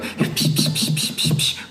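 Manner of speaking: rapid hushed splashing sounds; imitated paddling noise. Telling how the kid paddled with his arms, imitated splashing. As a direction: mimic frantic paddling with short "psh" bursts. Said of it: "he starts going".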